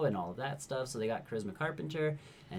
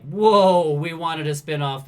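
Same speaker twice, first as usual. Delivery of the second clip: loud, higher-pitched "whoa" of protest; alarmed objection; 6 semitones higher, 14 dB louder, much slower than usual. Retold or imitated. Network reacting to a too-dark Angel episode, loud mock alarm. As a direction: put the brakes on with a loud, mock-alarmed outburst.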